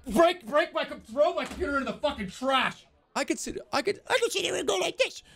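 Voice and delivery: high-pitched, hammed-up meltdown; mock triggered rage. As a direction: rant like an enraged viewer losing it. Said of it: "you guys just burn your computers".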